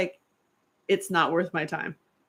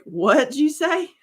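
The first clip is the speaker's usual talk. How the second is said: incredulous laugh; 7 semitones higher, 5 dB louder, slower than usual. Retold or imitated. Asks in disbelief, laughing.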